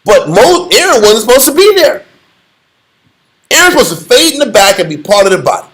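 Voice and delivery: normal talking voice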